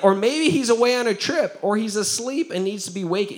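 high, punchy teasing; making fun. Retold or imitated. Elijah throwing out yo mama jokes, teasing. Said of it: "making fun of things".

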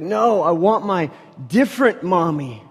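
half-acted child wailing for his mother; a touch of a three-year-old crying for mommy. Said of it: "He's like".

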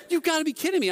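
high-pitched incredulous protest; exasperated, mad. Retold or imitated. Tourist sees the salesman gaining on him, mock exasperated. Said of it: "I was mad".

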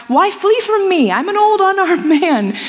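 put-on old man crying out to his runaway disciple; calling out. A narrator's reading. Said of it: "runs after him and cries".

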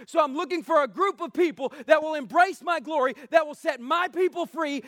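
driving, emphatic sermon delivery; forceful preaching. Real, not acted. Preacher urging the church to set God's people free, forceful preaching. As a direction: preach it hard, phrase upon phrase.

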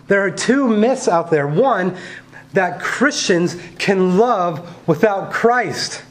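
preaching. Acted. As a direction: preach it with rising passion.

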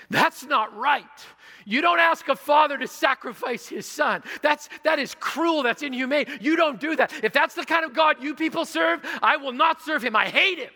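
loud, high furious protest; angry outburst. Retold or imitated. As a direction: shout your protest at a cruel God. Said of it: "he got angrier and angrier".

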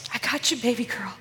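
soft, loving voice; tender reassurance. Retold or imitated. Holy Spirit speaking to her, tender. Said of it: "I could feel him saying".

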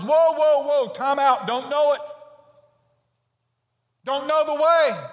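bewildered Thomas calling time out; Thomas protesting. Acted. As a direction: throw up your hands like a confused disciple. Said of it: "Thomas says".

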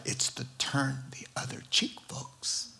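"turn the other cheek" in a breathy voiceless whisper; hushed whisper. Real, not acted. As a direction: whisper the punchline to the room. Whispered punchline to congregation.

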